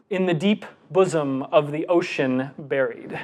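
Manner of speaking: slow recital, a breath after each ten syllables; slow, well-breathed recital. Shown as a demonstration. Teaching the audience to pace breath, slow deliberate verse. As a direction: give every word its full weight, breathing at line ends. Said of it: "I was able to pronounce every single word".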